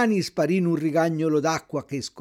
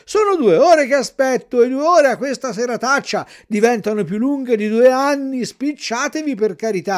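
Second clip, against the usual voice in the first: higher, louder impatient begging; pleading Pinocchio; 6 semitones higher, 6 dB louder than usual. A narrator's reading. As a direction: urge her to hurry, cold and fed up. Pinocchio begs in the rain. (Pinocchio implora la lumaca di sbrigarsi, più forte)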